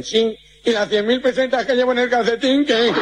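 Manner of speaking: jokey speech-impaired victim; nasal-speech man. Acted. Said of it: "hace así el gangoso".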